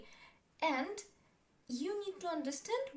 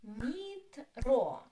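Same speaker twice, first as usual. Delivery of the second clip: "Metro" drawn out, with a louder ro; syllable by syllable; 5 dB louder than usual. Shown as a demonstration. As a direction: split "Metro" into two long syllables. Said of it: "Two syllables".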